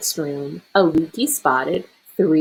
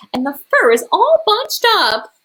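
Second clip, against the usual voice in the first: mama bear huff; 13 semitones higher, 5 dB louder than usual. A narrator's reading. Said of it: "she huffed".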